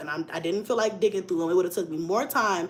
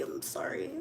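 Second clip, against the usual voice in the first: breathy whisper, much softer; whispered sorry; 14 dB quieter than usual. Retold or imitated. Apologizing to her date for being late, whispered. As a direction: shrink into a tiny apology.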